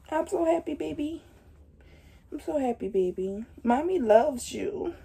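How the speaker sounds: soft, tender cooing. Real, not acted. Mother coos softly to her unborn baby.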